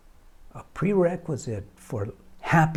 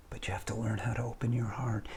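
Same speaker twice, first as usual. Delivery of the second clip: hushed, intimate whisper; 7 semitones lower, 13 dB quieter, faster than usual. Acted. Speaker to viewers, whispering about opening the heart. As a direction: breathe it like a secret.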